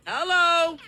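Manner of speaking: bright call to the audience. Real, not acted. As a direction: sing out a hello to the crowd. Calling out again, higher.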